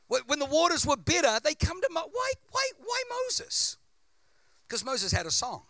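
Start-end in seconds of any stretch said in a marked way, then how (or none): 0.1-3.8 preaching at full force. Real, not acted. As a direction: build to a fiery preacher's peak. Preaching climax.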